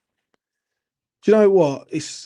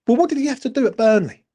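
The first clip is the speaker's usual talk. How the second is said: mocking fans' question; 8 semitones higher, faster than usual. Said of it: "first thing people say is this".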